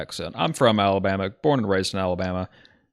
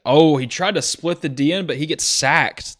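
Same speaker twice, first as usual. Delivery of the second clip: higher, louder, then slowing on the sack; excited call; 6 semitones higher, 5 dB louder than usual. Real, not acted. Sack called live.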